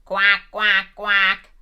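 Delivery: loud quacking duck voice; a duck greeting each guest at the door with loud quacks. Acted. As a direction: quack it out brightly like a little duck. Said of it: "The sheep arrived fashionably late".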